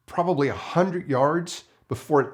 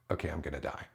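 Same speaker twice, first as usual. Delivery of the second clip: scared resignation; 10 semitones lower, 13 dB quieter, much faster than usual. Retold or imitated. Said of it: "I'm like".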